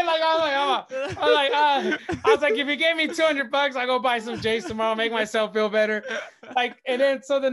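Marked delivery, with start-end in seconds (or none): 0.0-6.0 higher and louder, laughing as he talks; giddy, talking himself into a treat; laughing excitement. Retold or imitated. Retelling his excited hope.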